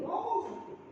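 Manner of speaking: "Ngomong sama Tuhan" called out high; high exclaim. Shown as a demonstration. Preacher urging the congregation, "Ngomong" called out high. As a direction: lift "Ngomong" high and bright. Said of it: "repeats phrase, then whispered".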